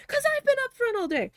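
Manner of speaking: high, loud exasperated outburst; losing it. Retold or imitated. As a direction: snap back at the boss, fed up. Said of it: "I lost it".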